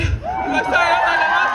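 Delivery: laughing through a sheepish apology; flustered laugh. Real, not acted. Young speaker laughing at losing her place in the speech. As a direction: giggle at losing your place.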